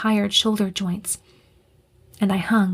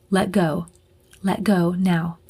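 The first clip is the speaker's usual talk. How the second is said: deeper voice; 3 semitones lower than usual. Acted. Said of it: "came a deeper voice".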